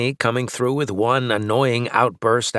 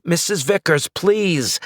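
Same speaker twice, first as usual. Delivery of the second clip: angsty plea; 9 semitones higher, slower than usual. A narrator's reading. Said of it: "putting as much angst into my voice as I could".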